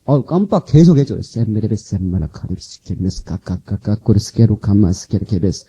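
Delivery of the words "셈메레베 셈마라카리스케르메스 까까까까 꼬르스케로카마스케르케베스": 낮고 느리게 중얼대는 방언 기도 흉내; 방언을 낮은 음으로 느리게 이어서. Retold or imitated. 방언 기도를 재연하는 간증. (낮게 읊조리는 방언 기도)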